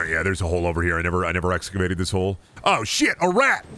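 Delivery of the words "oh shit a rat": sudden high yelp of alarm; jumpy exclamation at a sudden sight; startled. Real, not acted. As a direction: blurt it out high, caught off guard. Startled by a rat in game.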